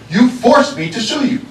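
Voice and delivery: forced leaned on hard in an angry rebuke; stress on forced. Real, not acted. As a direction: sheriff rebuking the board, hitting forced. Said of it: "I want to emphasize the word forced".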